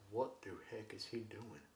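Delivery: very soft, low mock puzzlement; hushed bafflement. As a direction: imitate baffled onlookers under your breath. Acts out baffled NASA staff.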